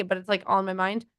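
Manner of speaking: ordinary talk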